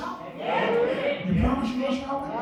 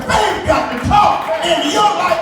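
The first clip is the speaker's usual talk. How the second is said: high and loud preacher's shout; 5 semitones higher, 10 dB louder than usual. Acted. Preacher to his church, shouted. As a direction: throw it out to the back row. Preacher repeats line, building.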